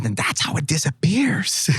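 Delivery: breathy whispered mumble to hide the trick; mumbled whisper. Retold or imitated. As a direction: whisper it under your breath like a secret. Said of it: "And then I start mumbling".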